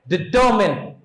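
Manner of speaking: "डिटरमाइन" with the last syllable short, as "-min"; correct pronunciation. Shown as a demonstration. Teacher giving children the correct pronunciation, said properly. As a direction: say "डिटरमाइन" as di-TUR-min, not "-mine". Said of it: "इसका correct pronunciation होता है".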